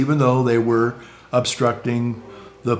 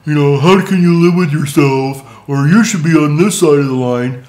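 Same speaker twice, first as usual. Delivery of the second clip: mock taunting protester; 3 semitones higher, 7 dB louder than usual. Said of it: "yelling and cursing at 'em".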